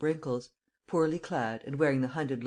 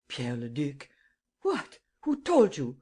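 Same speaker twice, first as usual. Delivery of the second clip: taken aback; slower than usual. A narrator's reading. Old man startled by the name, sharp surprise. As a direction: jolt of shock. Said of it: "utterly taken aback".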